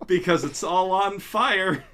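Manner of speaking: normal talking voice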